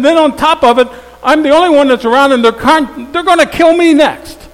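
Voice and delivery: high, loud whiny complaint; self-pitying Elijah. Acted. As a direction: wail it like a sulking prophet at a pity party. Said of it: "he's having a pity party".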